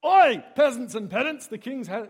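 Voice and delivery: loud, high cry easing off toward the end; mock herald's proclamation. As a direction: bellow a comic royal announcement. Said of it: "our Australian kerygma is".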